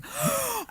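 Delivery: high sharp mock gasp; a gasp of sudden recognition; imitated starstruck gasp. Host mimics the starstruck lady's sharp gasp. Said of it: "She's like".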